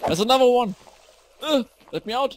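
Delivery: loud, high cry of alarm; real jump-scare panic; panicked shout. Real, not acted. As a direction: yell to escape, startled. Trapped by another creeper.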